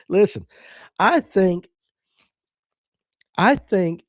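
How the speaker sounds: laughing. Real, not acted. Laughing at viewer's joke.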